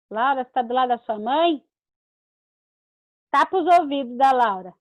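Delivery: usual speaking voice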